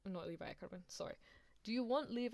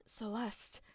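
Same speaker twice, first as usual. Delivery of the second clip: husky, half-whispered character call; Pink Afton; 3 semitones higher than usual. Acted. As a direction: breathe the name out in character. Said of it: "I'll do Pink Afton voice for now".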